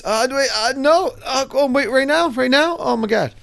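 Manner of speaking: panicked. Retold or imitated. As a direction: beg for a moment, caught off guard. Surprised by second jump.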